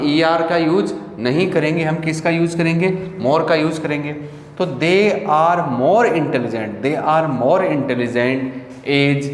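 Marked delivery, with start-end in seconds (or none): 4.6-6.7 very slow, stretched words; slow dictation. Shown as a demonstration. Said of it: "teacher dictates slowly". 6.8-9.3 very slow, pausing before the last words; slow dictation. Shown as a demonstration. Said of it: "teacher repeats slowly".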